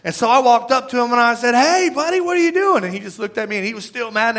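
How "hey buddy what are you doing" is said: high, bright-voiced hey buddy to a child; friendly call to a kid. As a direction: call out cheerfully to a sulking boy. Calling out to upset kid.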